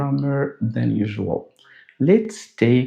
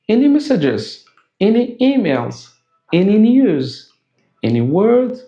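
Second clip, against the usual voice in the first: higher-pitched, crisp example questions; short spoken questions; 8 semitones higher, 4 dB louder than usual. Shown as a demonstration. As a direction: ask each as a brief, separate question.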